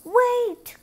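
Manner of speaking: high, loud call after someone fleeing; the prince calling after Cinderella as she runs. Acted. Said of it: "called the prince".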